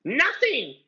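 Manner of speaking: very high loud triumphant ghost shout; spring the trick with glee; squealing mocking poltergeist; gloating poltergeist. A narrator's reading. Peeves tricking Filch.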